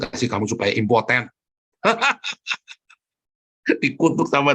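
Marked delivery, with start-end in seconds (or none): 1.8-2.7 high burst of laughter. Real, not acted. Preacher laughing at his own curse, high. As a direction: break into a laugh.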